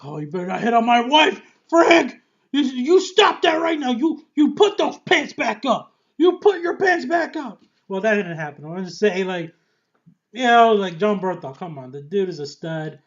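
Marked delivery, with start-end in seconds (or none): 0.0-7.6 high, loud panicky pleading; a frantic, jealous husband yelling at Frank; nervous husband. Retold or imitated. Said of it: "being all nervous, like".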